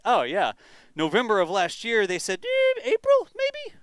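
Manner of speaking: mock-casual shrug of a date; mock vague guess. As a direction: toss it off like a noncommittal guess. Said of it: "It wasn't like".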